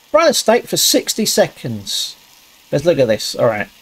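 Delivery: normal talking voice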